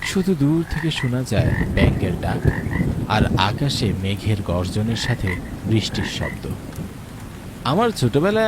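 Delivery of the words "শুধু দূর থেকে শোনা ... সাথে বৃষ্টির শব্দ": calm, measured storyteller voice; wistful story narration over music. A narrator's reading. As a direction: set the scene like a radio drama narrator. Narrator describes the night.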